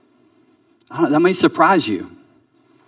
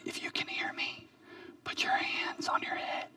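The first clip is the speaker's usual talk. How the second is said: put-on a teacher's hushed whisper to little kids; small voice; 21 dB quieter than usual. Teacher whispering to little children to get their attention. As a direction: whisper so the class has to lean in and listen. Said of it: "She uses a small voice".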